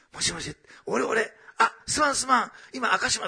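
high, loud imitation of a hurried apology; flustered phone call. Rescued husband phoning his anxious wife, flustered and urgent.

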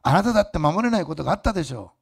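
loud, driving preacher voice; fervent preaching. Acted. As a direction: press it on the congregation. Preaching at full force.

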